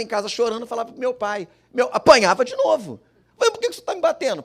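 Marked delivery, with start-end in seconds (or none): 3.4-4.4 put-on higher, fast, pleading child; child's protest. Boy pleading with his father, why are you hitting me. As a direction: whine it like a beaten kid.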